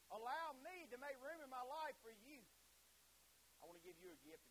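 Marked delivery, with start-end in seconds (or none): 0.1-2.5 slow, high, raised plea; impassioned prayer. Acted. Preacher praying at the altar, fervent. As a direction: cry it out to God, drawn out.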